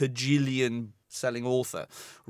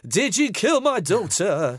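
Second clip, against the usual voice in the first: slow, louder, hammed-up theatrical plea; 5 semitones higher, 5 dB louder, slower than usual. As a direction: overact the desperate father, slowly. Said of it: "he doesn't go through the gated community going".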